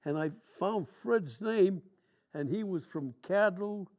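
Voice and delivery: normal talking voice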